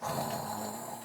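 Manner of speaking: snore. Acted. Dog snoring in the story.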